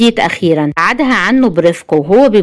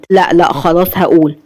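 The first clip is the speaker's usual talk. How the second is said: usual speaking voice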